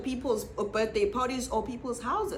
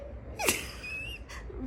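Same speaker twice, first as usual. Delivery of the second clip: high airy laugh; 11 dB quieter than usual. Real, not acted. Laughing after story.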